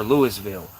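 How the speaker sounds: Louisville as LOO-iss-vill; mispronounced Louisville. Shown as a demonstration. Showing another outsider version, Louisville as LOO-iss-vill. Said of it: "Nobody can pronounce it right".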